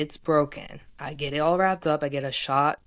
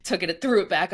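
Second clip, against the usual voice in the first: ordinary delivery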